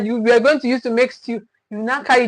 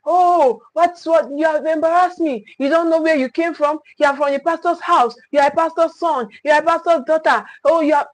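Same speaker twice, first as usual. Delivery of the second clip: high-pitched acted telling-off, not a full scream; mock screaming scolding; 4 semitones higher than usual. Shamed parent telling off a child at school, mock screaming. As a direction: imitate an embarrassed parent scolding. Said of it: "start screaming".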